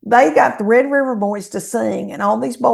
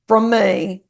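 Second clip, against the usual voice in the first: normal talking voice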